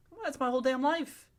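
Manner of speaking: high-pitched comic protest; exasperated high voice, joking; mock outrage. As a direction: play-act indignant disbelief up high. Same mock outrage, after a pause.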